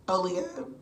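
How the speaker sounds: usual speaking voice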